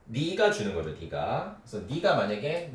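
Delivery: normal talking voice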